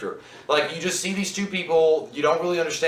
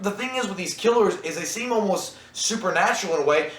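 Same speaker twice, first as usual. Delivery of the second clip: normal talking voice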